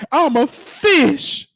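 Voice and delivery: a hint of a tearful church lady, high and slow; mock crying lady. Sister misheard, retold in a mock tearful voice. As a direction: lightly play the sobbing sister. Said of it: "and the lady said".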